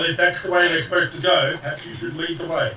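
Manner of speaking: ordinary delivery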